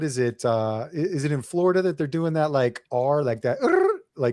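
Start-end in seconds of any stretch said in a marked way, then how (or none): 3.6-4.0 "Er" as a high trilled R; tongue-rolled "Er". Shown as a demonstration. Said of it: "roll an R".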